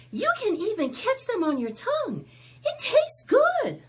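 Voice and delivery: quick, high and loud wonder; high girl's voice. Acted. Abby marveling at the snowflakes to Pedro, high and quick. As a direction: gush about it like a delighted little girl.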